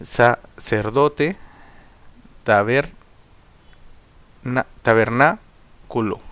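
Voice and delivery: "Sacerdote, tabernáculo" broken into slow pieces with pauses; split into syllables. Shown as a demonstration. Teacher showing separation of words at line ends, slowly split syllables. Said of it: "debe tener en cuenta dicha separación".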